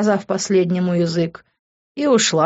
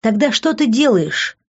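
indignant; 6 semitones higher, 3 dB louder than usual. Acted. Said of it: "возмутилась она".

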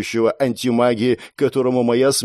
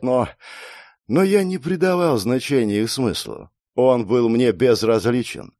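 usual speaking voice

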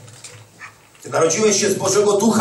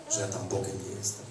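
quiet aside; 11 semitones lower, 13 dB quieter than usual. Real, not acted. Confiding aside to congregation.

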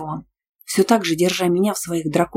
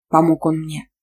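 plain reading voice

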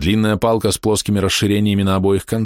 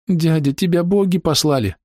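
put-on sniffling boy; 10 semitones higher than usual. A narrator's reading. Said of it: "шмыгая носом".

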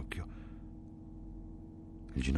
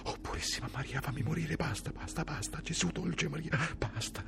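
high, softer pleading prayer, breaking; desperate dying prayer; 11 semitones higher, 3 dB quieter than usual. Acted. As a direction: beg heaven to let him die, high and thin. Dying soldier prays and begs. (preghiera disperata, acuta e sommessa)

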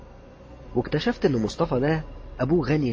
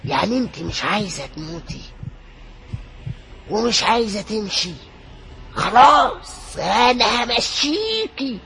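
much higher, slow and drawn out threats; menacing frantic young man; 11 semitones higher, 6 dB louder, slower than usual. Acted. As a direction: threaten the ghost slowly, wild and unhinged. Youth threatening to kill again. (شاب مجنون بيهدد ببطء وبصوت عالي)

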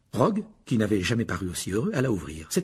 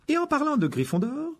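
higher, tart schoolmistress tone; curt. A narrator's reading. The professor turns to house matters, curtly. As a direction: clip the start of a scolding. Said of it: "reprit sèchement".

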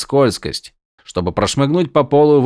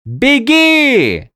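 loud high far yell; 5 dB louder than usual. Acted. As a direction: yell it again urgently. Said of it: "заорал Виндель".

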